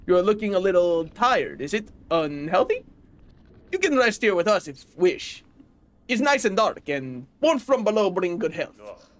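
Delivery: a caring flea offering rest; fake European accent. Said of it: "that accent was racist".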